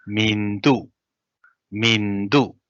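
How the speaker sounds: "Mindu" with an n before the d; nasal "mindu" twice; nasalized mindu. Shown as a demonstration. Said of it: "nasal eyes are more common".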